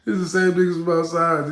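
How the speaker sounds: loud incredulous exclamation; loud exclaimed repeat. Shown as a demonstration. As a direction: shout it out as the shock hits. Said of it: "repeated loud".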